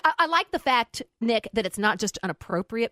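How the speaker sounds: ordinary delivery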